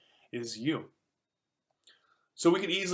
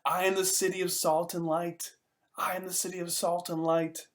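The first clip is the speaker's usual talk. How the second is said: higher-pitched mock street-crier's call, no real volume; half-hearted, high-pitched proclamation; mock scream; 5 semitones higher than usual. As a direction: play a lone man crying out to an empty town. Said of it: "one lone man in the street screaming".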